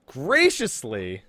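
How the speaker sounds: slow, drawn-out sarcastic repeat; sarcastic echo. Acted. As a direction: stretch the word in disbelief. Mocking his word.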